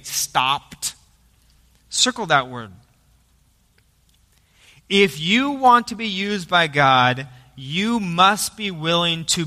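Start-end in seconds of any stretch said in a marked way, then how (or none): none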